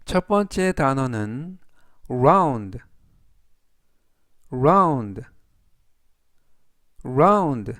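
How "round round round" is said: "round" said clearly as /raund/ with a falling tune, each take after a pause; teacher modelling "round" for students to repeat; careful "round", the ou as in "ow", falling at the end; model pronunciation. Shown as a demonstration. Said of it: "라고 발음하시면 되겠고요".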